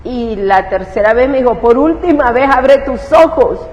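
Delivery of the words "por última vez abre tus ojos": strong voice of authority. Retold or imitated. Divine voice's last command to open her eyes, loud and stern. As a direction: command it, final warning. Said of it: "oí una voz como muy fuerte".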